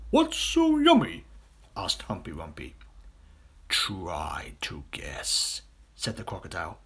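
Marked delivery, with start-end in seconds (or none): 0.1-1.2 much higher, slow question; curious hippo. Acted. Hippo asks the crocodile, high curious voice. 3.7-5.6 very slow teasing whisper; sly whisper. Acted. Crocodile teases the hippo in a sly whisper.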